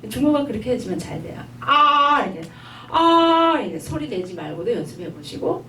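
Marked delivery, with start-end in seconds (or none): 1.6-2.2 아 with the mouth wide open, high. Shown as a demonstration. Teacher showing a wide open 아 to the class. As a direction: open 아 so the uvula shows. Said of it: "입을 크게 벌려서 목젖이 보이도록".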